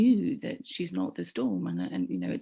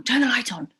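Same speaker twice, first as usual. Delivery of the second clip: panicked plea repeated; 6 semitones higher, 7 dB louder than usual. Retold or imitated.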